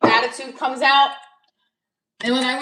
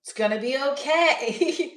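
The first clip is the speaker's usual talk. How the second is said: placating soothe; 4 semitones higher than usual. Retold or imitated.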